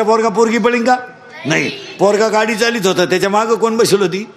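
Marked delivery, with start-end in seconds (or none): none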